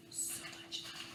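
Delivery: soft breathy whisper. Acted. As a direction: whisper it fondly. Whispered after the list.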